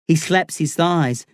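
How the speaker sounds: ordinary delivery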